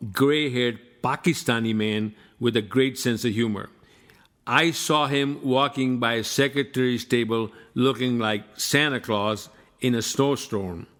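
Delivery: usual speaking voice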